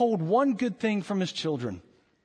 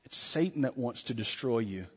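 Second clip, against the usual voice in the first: ordinary talk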